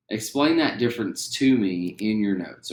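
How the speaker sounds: ordinary talk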